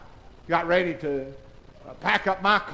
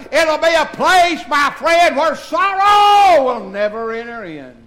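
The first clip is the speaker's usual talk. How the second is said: full-force preaching; 5 semitones higher, 9 dB louder than usual. Acted. Pastor lifting the congregation toward heaven, full-force preaching.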